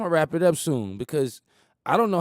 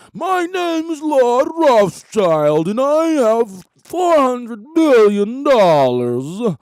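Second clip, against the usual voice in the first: slow, loud and much higher, grandly drawn out; pompous lord; 7 semitones higher, 9 dB louder, much slower than usual. Retold or imitated. Lord Rothschild naming himself and his fortune, grand boast.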